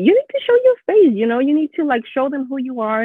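high, fast, pushy urging; pushy husband. Retold or imitated. Imitating her husband, who pushes her to show her face, high and fast. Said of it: "he was one of the people pushing me".

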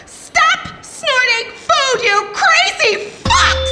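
high, shrill outburst at the crowd; angry yelling. Acted. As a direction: yell it in outrage. Concerned mom yelling at hearing.

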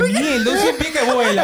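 high, loud frightened laughing yell; panicked outburst. Retold or imitated. Centipede lands on his back. (grito asustado entre risas)

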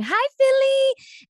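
very high, loud, drawn-out celebrity greeting; exaggerated high-pitched star shout, last vowel held. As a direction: squeal it out slowly to a whole crowd. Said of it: "She was going".

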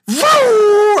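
high, loud engine roar; loud vroom of a race car. Retold or imitated. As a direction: roar like a car flying past. Imitating race car passing.

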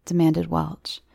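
usual speaking voice

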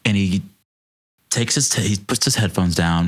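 ordinary delivery

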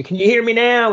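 high, excited imitation of a woman showing off; mock healed woman. Mocking the supposedly healed woman calling to the crowd, high and excited. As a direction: parody her waving and calling to the crowd. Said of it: "She's go".

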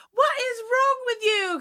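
high, loud imitation of an exasperated mum; exasperated mother. Movie mother yelling at her screaming son, hammed-up exasperation. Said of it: "where she's like".

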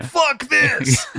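high-pitched comic exasperation; hammed-up angry quote; mock fed-up outburst. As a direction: act out a performer storming off. Acts out Bruce quitting the show.

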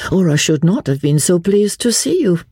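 high, teasing lady's voice; arch. A narrator's reading. Lady explains her delight at seeing him, arch and high. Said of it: "she asked archly".

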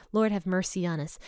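ordinary talk

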